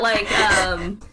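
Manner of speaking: ordinary talk, nothing marked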